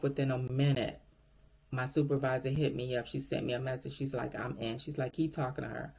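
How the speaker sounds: usual speaking voice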